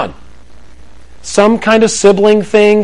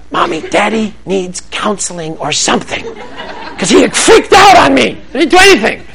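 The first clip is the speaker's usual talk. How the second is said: high, put-on child voice; kid complaining; 4 semitones higher than usual. Son telling mom that dad freaked out, whiny kid voice. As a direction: tattle to mom about dad. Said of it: "And he's like".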